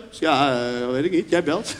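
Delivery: low, lost and mumbled; mock clueless mumble. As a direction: act like you have nothing to say. Clueless friend on phone.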